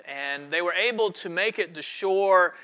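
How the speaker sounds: usual speaking voice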